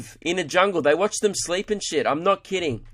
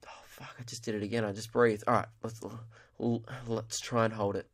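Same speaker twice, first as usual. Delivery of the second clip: much softer, lower, fast self-scolding under the breath; guilty mutter; 5 semitones lower, 9 dB quieter than usual. Acted. Contestant scolding herself in bed for breathing, soft fast guilty mutter. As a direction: mutter to yourself, ashamed, at speed. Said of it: "she's like".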